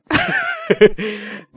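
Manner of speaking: shrill burst of laughter; high laugh. Real, not acted. Laugh at punishment game.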